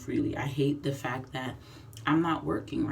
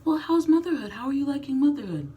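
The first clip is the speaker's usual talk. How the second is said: much higher, louder sing-song question; mock cheerful asker; 10 semitones higher, 6 dB louder, faster than usual. Mimicking people who ask a new mom about motherhood, high and bright. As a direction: chirp it like a nosy well-wisher. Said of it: "they ask you".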